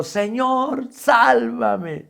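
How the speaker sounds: high, far-off cry for help; frightened cry. Acted. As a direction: cry out sinking in the waves. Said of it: "gritó diciendo".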